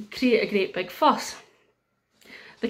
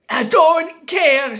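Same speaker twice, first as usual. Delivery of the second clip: loud, high, drawn-out refusal; defiant king; 5 semitones higher, 9 dB louder, much slower than usual. Acted. King refusing the wise women's advice, defiant shout. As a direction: stamp it out slowly and loudly.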